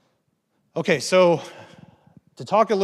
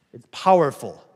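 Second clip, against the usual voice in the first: a sudden much louder punch on the whole line; emphatic; 10 dB louder than usual. Real, not acted. Speaker warning the audience about addiction, emphatic and much louder. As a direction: hit it hard so it lands.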